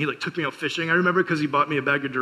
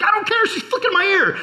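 put-on very high, louder childish protest; mock kid outburst; 4 dB louder than usual. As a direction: squeal it like an outraged little boy. Kid yelling back at mom.

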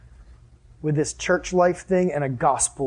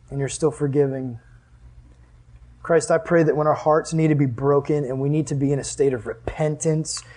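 prayer; 3 semitones lower than usual. Real, not acted. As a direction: pray it gently to God. Closing prayer.

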